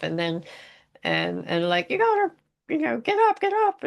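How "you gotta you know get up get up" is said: high-pitched, hurried mock urgency; squeaky, rushed pleading; urgent waking. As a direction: imitate a frantic wake-up call. Acting out waking Jesus in storm.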